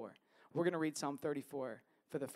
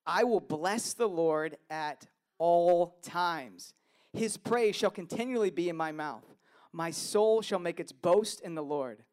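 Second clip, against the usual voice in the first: scripture reading; 6 dB louder than usual. Acted. Pastor reads Psalm thirty-four aloud to the congregation, proclaiming. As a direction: proclaim each verse to the room.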